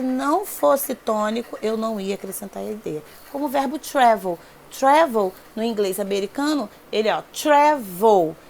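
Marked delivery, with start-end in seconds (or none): 4.8-5.3 loud "Travel", first syllable punched; teacher demonstrating "Travel" stressed at the front. Shown as a demonstration. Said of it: "a sílaba tônica do travel é o tra". 7.3-8.4 "travel" broken into two loud, deliberate syllables, falling at the end; teacher's syllable-by-syllable "travel", front-stressed; stress on first syllable. Shown as a demonstration. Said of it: "a sílaba tônica do travel é o tra".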